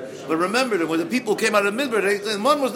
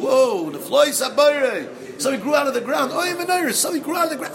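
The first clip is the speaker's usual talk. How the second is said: amazed high exclaim; 7 semitones higher, 4 dB louder than usual. Acted. Amazed at growth.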